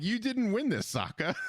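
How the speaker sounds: high mocking scold. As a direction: tease him, voice way up. Mocking Sokka's victory.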